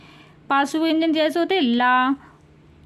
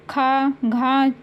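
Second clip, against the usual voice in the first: slow aspirated demo; much slower than usual. Shown as a demonstration. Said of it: "ये महाप्राण होते हैं".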